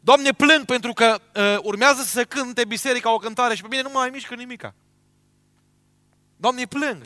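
a hint of a weeping believer begging God; mild mock lament to God; pleading prayer. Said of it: "Să plângi".

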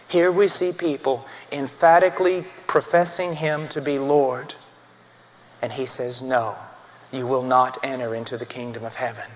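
low, hushed and weighty; subdued, low pulpit solemnity; solemn preaching. Real, not acted. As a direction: preach gravely in a soft, deep register with long pauses. Grave, hushed sermon passage.

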